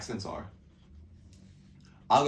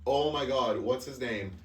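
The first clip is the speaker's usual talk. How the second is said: usual speaking voice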